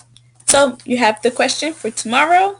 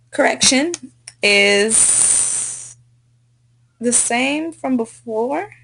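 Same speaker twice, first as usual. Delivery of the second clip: usual speaking voice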